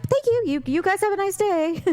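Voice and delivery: high-pitched sing-out pleasantry; mock politeness. Young woman answers old men, mock polite. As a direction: chirp it back politely.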